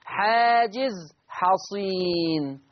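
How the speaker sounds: حاجز حصين drawn out very slowly; slow emphasis. Shown as a demonstration. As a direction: lean on each word of حاجز حصين, slowly. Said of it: "لأن الصاد الساكنة".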